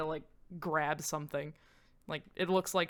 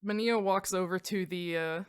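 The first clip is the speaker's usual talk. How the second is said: ordinary talk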